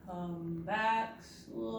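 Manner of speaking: drawn-out, dragging call; slow-motion beckoning; really slow. Shown as a demonstration. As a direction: stretch each word out long. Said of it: "say it really slow".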